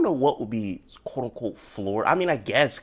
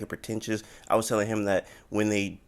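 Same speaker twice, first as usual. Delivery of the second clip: ordinary delivery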